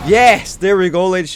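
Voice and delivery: high, loud victory call; triumphant cheer. Real, not acted. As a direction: celebrate the win. Victory celebration.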